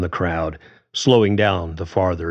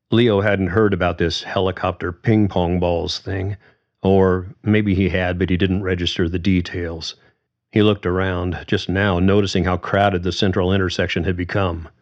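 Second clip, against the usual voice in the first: ordinary delivery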